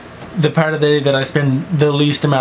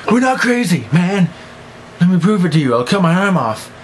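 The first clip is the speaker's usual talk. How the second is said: lark voice; 5 semitones higher than usual. Pretend. Voicing lark in story.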